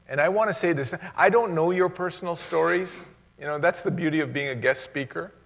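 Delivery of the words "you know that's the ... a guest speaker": ordinary delivery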